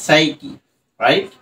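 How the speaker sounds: ordinary talk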